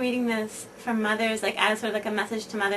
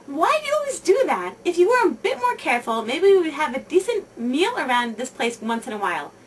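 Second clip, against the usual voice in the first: higher, louder nagging reproach; berating husband; 5 semitones higher, 6 dB louder than usual. Retold or imitated. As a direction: scold your wife over the burnt rice. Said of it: "Your husband berates you".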